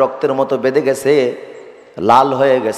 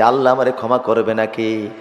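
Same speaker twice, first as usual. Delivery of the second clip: ordinary talk, nothing marked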